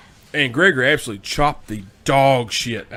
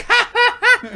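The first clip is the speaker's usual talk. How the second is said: much higher mocking laugh; imitated cackle; 5 dB louder, slower than usual. Imitating the fan mocking the crowd, high cackle. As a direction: cackle at the kid, imitating her. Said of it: "as only she can do".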